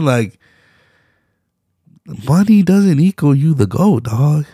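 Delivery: ordinary talk, nothing marked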